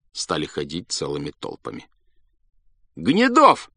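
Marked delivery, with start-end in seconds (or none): 3.0-3.7 much higher, louder guess; eager guess. A narrator's reading. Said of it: "говорили ему".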